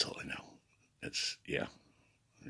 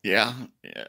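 ordinary delivery

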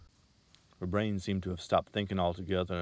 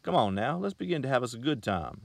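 higher, coaxing and sweet-talking; 6 semitones higher, 6 dB louder than usual. A narrator's reading. Salesman coaxing the girl to have fun, higher. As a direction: coax her into going along. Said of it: "he said coaxingly".

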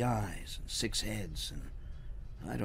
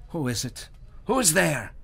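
rising, louder alarm; startled; 7 semitones higher, 6 dB louder than usual. Acted. Herschel woken by a voice, startled. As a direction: call out startled.

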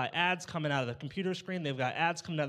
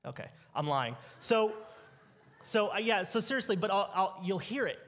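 ordinary talk, nothing marked

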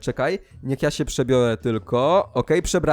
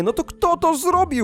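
high, exasperated outburst; 13 semitones higher than usual. Acted. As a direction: demand the culprit in mock frustration. Every suspect denies it.

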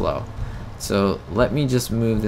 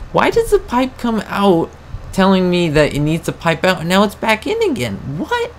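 exasperated at the tractor pipe, high and baffled; baffled outcry; 9 semitones higher than usual. Real, not acted. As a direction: pitch up in utter confusion.

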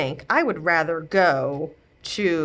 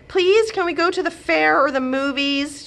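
put-on higher, eager kid begging; pleading child; 10 semitones higher than usual. A narrator's reading. Grandchild begs grandma for the fair, pleading kid voice. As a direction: beg sweetly like a little kid.

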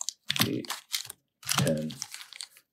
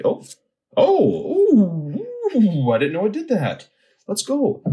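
excited surprise; 7 dB louder, slower than usual. Real, not acted. Card pull reveals holo surprise.

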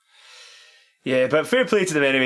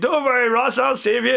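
big hero; 9 semitones higher, 5 dB louder than usual. Retold or imitated. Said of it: "thinking I'd be the big hero".

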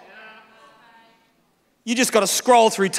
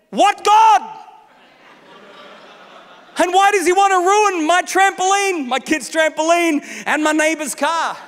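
indignant retort; 7 semitones higher than usual. Retold or imitated. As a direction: fire it back in disbelief. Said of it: "So I said to the insurance broker".

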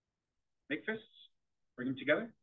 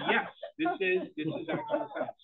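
high and louder, laughing through the words; laughing insistence; 4 dB louder than usual. Real, not acted. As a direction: laugh while you insist it's a fact. Laughing while insisting to disbelieving boys.

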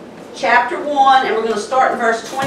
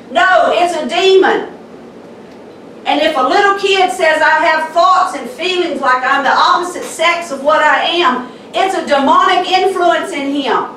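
fiery preaching; 4 semitones higher than usual. Acted. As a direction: pound the pulpit with rising fire. Preaching at full force.

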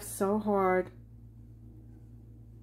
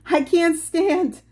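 loud, high, helpless cry; stuck and laughing; 9 semitones higher, 9 dB louder than usual. Retold or imitated. Stuck on the zipline, calling loud and high to her friend. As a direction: plead that you can't get up. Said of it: "I said".

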